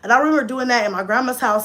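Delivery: normal talking voice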